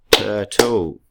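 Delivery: "Turtle" as tur... tle, loud; split into syllables. Shown as a demonstration. Teacher counts syllables for pupils, "Turtle" loudly in two beats. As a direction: punch out both beats of "Turtle". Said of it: "is two syllables".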